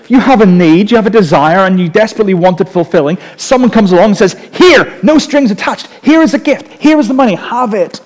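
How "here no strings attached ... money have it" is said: high-pitched, emphatic offering; acted-out generous urging, pitched well up; eager giver. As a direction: press a gift on someone insistently.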